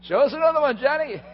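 high, loud, cheering demand; cheering crowd. Retold or imitated. Imagined crowd cheering John on, loud. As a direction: cheer for an encore. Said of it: "crowd cheering imagined".

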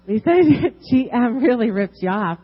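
can't hold back a laugh. Real, not acted. Woman repeating the manager's jab at GM, laughing.

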